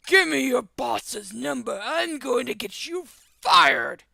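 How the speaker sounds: slow, loud acted threat; hammed-up outraged dad shout; shouting angry father. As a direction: bellow each word like a furious parent. Shouting father threatens driver's job.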